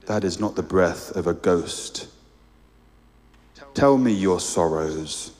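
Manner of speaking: deep calm voice of a great lion; large deep voice. A narrator's reading. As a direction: voice a huge gentle being, low in the chest. The creature speaks again.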